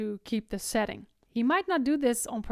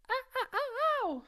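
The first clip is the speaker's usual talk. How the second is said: ah, ah, ah sung much higher, ending in ow; teacher adds the high pitch to the sound, high ah. Shown as a demonstration. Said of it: "layer on the voice".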